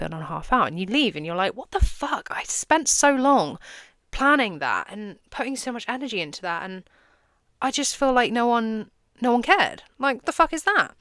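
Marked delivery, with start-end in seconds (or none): 1.5-11.0 mock outrage at a dead room; frustrated rant. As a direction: vent after nobody cared about the talk. Speaker's frustration after a flop.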